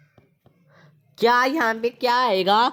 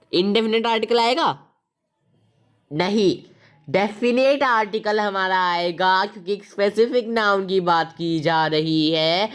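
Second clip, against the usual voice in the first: usual speaking voice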